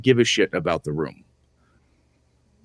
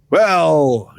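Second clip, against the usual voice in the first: mock haughty well; 9 semitones higher, 7 dB louder than usual. Mock lore-pushing author, acted.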